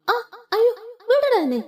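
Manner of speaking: high, loud cry of mock pain with a laugh in it; pained yelp. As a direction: yelp as if your arm is being twisted. Arm twisted, she cries out. (കൈ പിരിക്കുമ്പോൾ ഉയർന്ന, ഉറക്കെ വേദനയുള്ള നിലവിളി)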